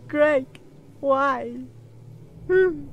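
loud, high hammed-up wail of grief; mock wail. Player wailing for dead teammate Greg, hammed-up mock wail. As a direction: wail his name like a soap opera.

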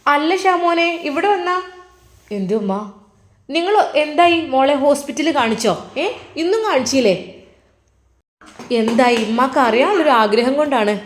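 chatty mother explaining on the phone. Acted. Mother asking about hospital. (മകനോട് ഫോണിൽ സംസാരിക്കുന്ന ഉമ്മ, അൽപം ഉച്ചത്തിൽ)

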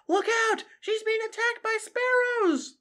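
very high, loud alarm cry; alarmed cry from a crowd; shout of consternation. A narrator's reading. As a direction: yell a panicked warning. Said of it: "shout of conster-, consternation".

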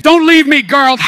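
loud begging call; pleading shout. Acted. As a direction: cry out for her to stay. Pleading to leaving character.

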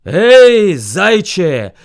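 very loud summons; bear's shouted call. A narrator's reading. Furious bear summons hare, shouting. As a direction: holler for the hare. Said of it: "ревів він".

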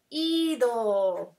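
"ido" with a long, emphatic first vowel; slow, stretched "ido" to show the accented i; stress on the í. Shown as a demonstration. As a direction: lean on the i of "ido" and draw it out. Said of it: "tiene acento en la I".

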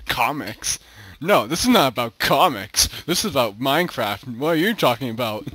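playful exasperated rant; mock outrage. Real, not acted. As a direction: scold the silly misreading. Mocking misread comment.

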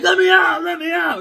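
loud, high shout of let me out; yelling to be let out. Retold or imitated. Boy locked in the tree fort yelling at the kids, loud. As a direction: bang on the door and yell. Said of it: "And I'm like".

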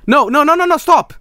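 high frantic no; panicked cry. Real, not acted. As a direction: beg it to stop. Game panic.

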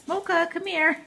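coaxing call to a pet; calling the dog. Real, not acted. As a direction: sing out her name to call her over.

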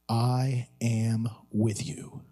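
slow, low and tender; solemn reassurance. Real, not acted. Preacher gives four simple words, solemnly reassuring. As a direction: lay out each word slowly in a low voice.

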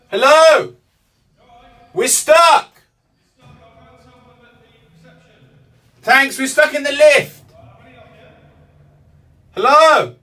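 full high yell, words stretched; shouting for help. Real, not acted. Trapped in a lift calling rescuers, shouting. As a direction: holler to someone far outside.

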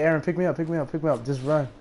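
ordinary delivery

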